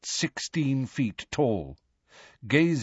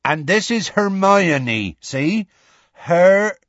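higher, slow, louder presenting; Hagrid introducing; 8 semitones higher, 6 dB louder, slower than usual. A narrator's reading. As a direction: present her to the giant.

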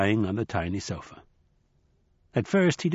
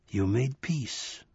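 buffalo man whisper; 6 dB quieter, much slower than usual. Acted. Buffalo man, soft and slow.

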